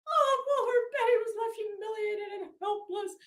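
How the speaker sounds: mock it like a Lifetime movie narrator; sarcastic soap-opera swoon; mock melodrama. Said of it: "is this a fucking Hallmark card?".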